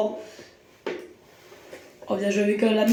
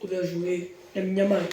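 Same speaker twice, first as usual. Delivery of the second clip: ordinary delivery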